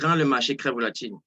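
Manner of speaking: normal talking voice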